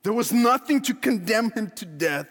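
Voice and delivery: usual speaking voice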